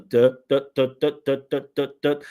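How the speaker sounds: "duh" repeated evenly on one level pitch; mechanical rhythm. Shown as a demonstration. Coach repeating the weak example, each "duh" mechanical and monotone. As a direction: drum out every "duh" with no melody. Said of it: "this is what we call mechanical pronunciation".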